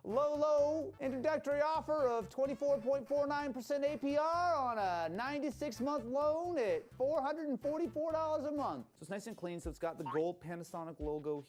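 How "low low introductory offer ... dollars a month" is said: used car salesman. Acted. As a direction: sell it like a pushy car dealer. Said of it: "Spoken like a used car salesman".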